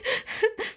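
short high sobbing breaths; little mermaid's crying; high whimpering sob; acted sobs. A narrator's reading. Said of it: "schluchzte Lilli".

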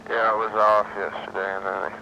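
drowsy, slowed-down drugged speech; sedated. Real, not acted. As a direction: talk heavy and sluggish, medicated. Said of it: "now you sound really slow motion".

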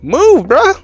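high, loud order to get aside; exasperated yell. Real, not acted. Player blocked by a game character, exasperated yell. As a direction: yell it sharply, pitch way up.

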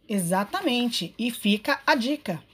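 normal talking voice

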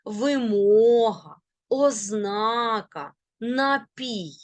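Вимога, ознака, напій with long stressed мо, зна, пій; stress on second syllable. Shown as a demonstration. Teacher drilling correct word stress. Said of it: "Зверніть увагу далі".

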